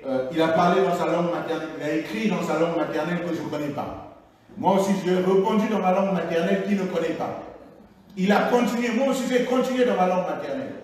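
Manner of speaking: ordinary delivery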